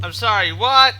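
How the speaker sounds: "what" jumps up high and loud; incredulous stress on what. Real, not acted. Player stunned by a setback, incredulous high "what". Repeats louder in disbelief.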